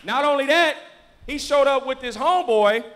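loud, high, triumphant build; excited pulpit shout; full-force preaching. Real, not acted.

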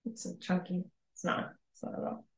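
barely voiced after choking on wine; croaky after choking on a drink. Real, not acted. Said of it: "I'm choking on my wine".

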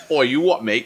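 quick jokey Cockney "you what, mate"; playful Cockney impression; mock Cockney. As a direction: bark it like a London geezer. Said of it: "That's not even Irish".

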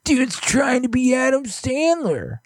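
high, slow sneering mockery; mock the online critics; mocking hater voice. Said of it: "They're like".

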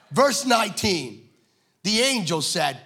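usual speaking voice

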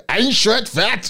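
slow, high, hammed-up German; exaggerated German accent. Repeating his French for a teasing friend, exaggerated German accent. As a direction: ham up the German accent slowly. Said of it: "It sounded German".

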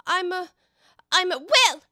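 high, loud false starts with pauses; flustered. Acted. Alice unsure what she is, flustered and loud.

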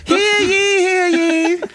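high, slow, booming proclamation voice; town crier. Pretend. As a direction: announce it like an olde town crier. Colonial bonnet job, mock proclamation.

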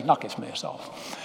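slow, breathy whisper of Knock this mess off; whispered self-talk. Shown as a demonstration. Preacher showing the congregation how to talk to themselves, whispered. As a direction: whisper it to yourself, slowly. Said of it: "just say".

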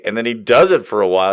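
usual speaking voice

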